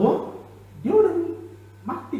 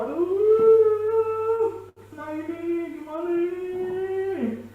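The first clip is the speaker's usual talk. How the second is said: higher, drawn-out wail of despair; wailing mourner; 11 semitones higher, much slower than usual. Acted. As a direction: cry out like a grieving relative. Mourners panicking over dead child.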